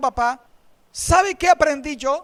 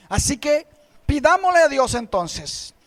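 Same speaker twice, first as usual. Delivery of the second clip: ordinary talk, nothing marked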